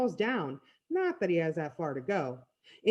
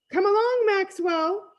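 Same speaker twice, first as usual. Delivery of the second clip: high, loud character voice; high-pitched summons thrown behind you; calling over the shoulder; 12 semitones higher, 5 dB louder, slower than usual. A narrator's reading. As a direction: call a friend along, high and lofty. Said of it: "he says over his shoulder".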